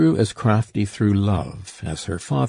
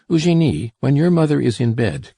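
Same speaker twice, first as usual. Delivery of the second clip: higher, louder call up the stairs; 6 semitones higher, 5 dB louder than usual. A narrator's reading. Father calling up the stairs to his daughter, higher and louder. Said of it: "called out".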